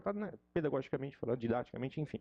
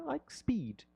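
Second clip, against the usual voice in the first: I like speed said higher and bright as the right form; correct native version; 9 semitones higher, much slower than usual. Shown as a demonstration. Teacher giving the native alternative. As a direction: offer I like speed as the natural choice. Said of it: "A gente vai falar".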